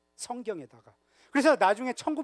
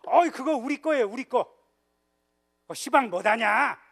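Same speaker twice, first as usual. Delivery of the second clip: put-on higher, louder Jeolla accent, claiming it proudly; Jeolla dialect; 4 semitones higher, 5 dB louder than usual. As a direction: Jeolla folks protesting in broad dialect. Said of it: "전라도 분들이 그러시더라고요".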